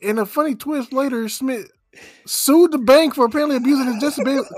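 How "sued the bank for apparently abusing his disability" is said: usual speaking voice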